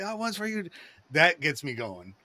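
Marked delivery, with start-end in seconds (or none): 0.0-0.6 a high mock announcement to the kid; imitating Babe Ruth. Babe Ruth to the crowd: high, excited voice. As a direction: voice Babe calling out to the boy, pitched up.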